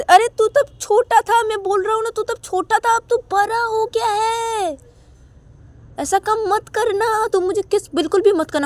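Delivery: high, loud big-sister voice telling him off; high-pitched sister warning her little brother; scolding older sister. Acted.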